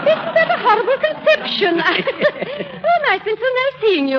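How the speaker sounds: giggling high through the words; laughing. Acted. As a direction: titter at your own joke. Lady giggles at own joke.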